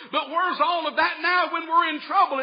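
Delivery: preached complaint. Retold or imitated. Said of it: "That was Gideon's position".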